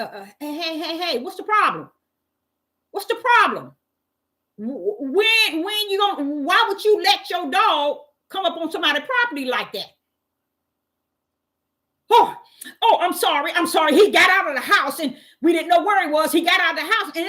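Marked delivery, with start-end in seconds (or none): 0.4-10.0 retold confrontation. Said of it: "but I said". 12.1-17.1 apologetic neighbor. Retold or imitated.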